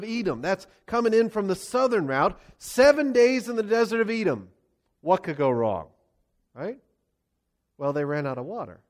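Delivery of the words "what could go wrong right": dry, lower sarcastic aside; deadpan irony, voice dropped. Real, not acted. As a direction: say it like disaster is obvious. Ironic aside.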